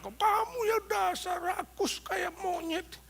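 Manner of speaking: high, slow, drawn-out scolding; imitating a nagging, angry wife; mock angry wife. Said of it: "marah-marah".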